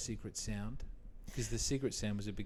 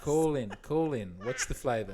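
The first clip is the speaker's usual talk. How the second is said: radio host; 3 semitones higher, 7 dB louder than usual. Retold or imitated.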